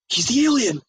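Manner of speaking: frantic shout. Retold or imitated. Panicked realization, repeated cries.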